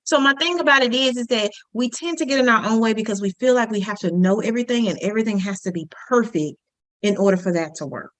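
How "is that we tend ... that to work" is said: ordinary talk, nothing marked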